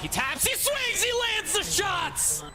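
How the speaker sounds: high, slow, shouted hype call; esports caster. Acted. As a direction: scream the clutch to the crowd.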